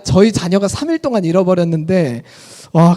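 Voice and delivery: usual speaking voice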